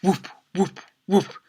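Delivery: short high "whoop" noises; whooshing stick. A narrator's reading. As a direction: imitate a stick whirling through air. Imitating a whirled walking stick.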